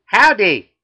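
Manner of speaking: loud, high southern holler; southern accent. Acted. Customer walks into the deli, loud southern accent. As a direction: boom it out in a Southern drawl. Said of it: "he's got a southern accent".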